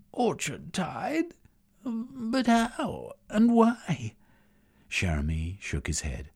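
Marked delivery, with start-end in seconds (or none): 0.1-4.1 high, slow stunned question; disbelieving gasp; astonished woman. Acted. Schwinifere astonished.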